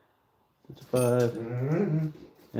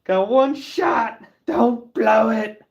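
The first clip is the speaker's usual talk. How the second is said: tense self pep talk; 11 semitones higher, 8 dB louder, much slower than usual. Real, not acted. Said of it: "part I don't wanna screw up".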